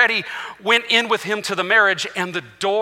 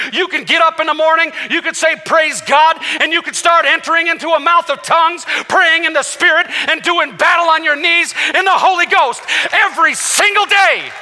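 preaching at full force; 5 semitones higher than usual. Real, not acted. Preacher urging the congregation to pray in tongues, fervent. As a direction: drive it home to the church.

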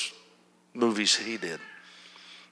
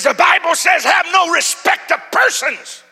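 screaming; 10 dB louder than usual. Retold or imitated. Screaming man throws scripture at the preacher, screaming. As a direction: scream the verse in someone's face. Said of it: "started screaming at me".

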